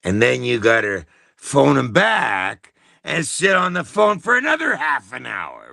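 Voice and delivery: usual speaking voice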